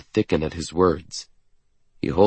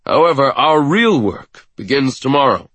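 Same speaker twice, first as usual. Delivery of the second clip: raised, stern announcement; commanding director; 7 semitones higher, 5 dB louder than usual. A narrator's reading. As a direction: lay down the challenge. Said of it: "he continues".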